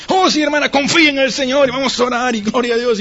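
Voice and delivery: high, effusive believer's reassurance; gushing church encouragement. Acted. Church member answers a troubled hermana, gushing encouragement. Acted eager reply to someone's troubles.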